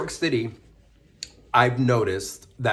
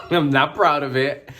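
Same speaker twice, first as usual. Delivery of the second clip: laughing; 4 semitones higher, 5 dB louder than usual. Real, not acted. Laughing after the fight story.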